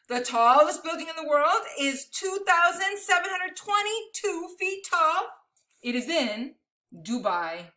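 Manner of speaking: animated, emphatic picture-book reading; big numbers stretched out for effect; read-aloud to children. Acted. Reading a picture book of amazing numbers to children, animated storytelling.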